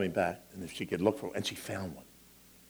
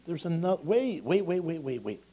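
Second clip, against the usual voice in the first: higher, excited repeated waits; mock-urgent stalling, pitched up; playful wait; 7 semitones higher than usual. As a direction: tease the child, stalling. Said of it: "Because it's".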